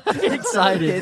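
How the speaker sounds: said through laughter; giggling confession; laughing. Real, not acted. Laughing at joke.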